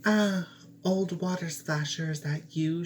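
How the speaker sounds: put-on high, bright young princess voice; a princess, pitched high and startled; high-pitched girl answering the frog.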